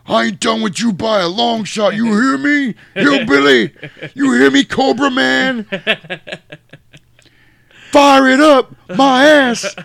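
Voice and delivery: high, loud squealing taunt; high hysterical threat screamed at the rivals; Luther mock yell. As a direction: shriek it like a cornered punk. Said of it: "He's like".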